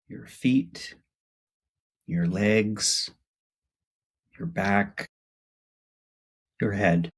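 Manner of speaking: unhurried body-scan voice, spaced phrases; guided meditation. Acted. Host guiding listeners to notice their body, guided meditation. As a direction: lead them through the body, pausing after each part.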